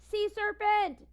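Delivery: high loud slow terrified shout; beagle screams. A narrator's reading. Beagle mistakes elephant trunk for monster, screaming. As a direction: scream it in panic. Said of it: "screams the ba-, the beagle".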